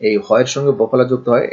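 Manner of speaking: ordinary talk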